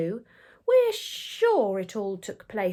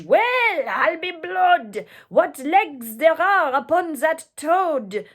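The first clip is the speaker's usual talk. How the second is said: high, loud mock scream; screaming French mob; 6 semitones higher, 8 dB louder, slower than usual. The French mob screams at the toad, high and loud. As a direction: yell it like a hungry mob. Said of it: "They screamed in French".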